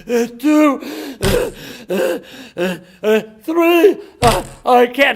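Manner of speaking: loud, high strained panting; struggling, winded. Acted. Guy doing jumping jacks, acted gasping struggle. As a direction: force the count out between gasps. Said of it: "he's having a real hard time".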